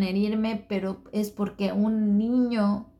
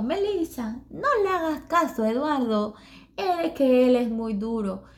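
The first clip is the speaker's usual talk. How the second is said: sweet friend voice; 6 semitones higher than usual. Retold or imitated. Japanese friend consoling her after Eduardo's words, high sweet voice. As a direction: comfort her like a little cartoon girl.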